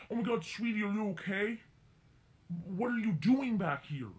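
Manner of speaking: imitating a worried lady who says sweetie.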